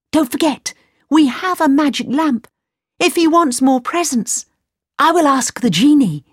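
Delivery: put-on high, urgent boyish voice; pleading young Aladdin. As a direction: son begging mother to go.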